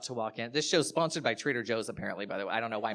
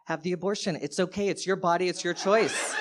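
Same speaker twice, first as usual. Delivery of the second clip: higher, louder, showy supportive friend; fake supportive call; 4 semitones higher, 4 dB louder than usual. Comic faking a call for canvassers, loud and showy. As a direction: perform liberal support for listeners. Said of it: "I was like".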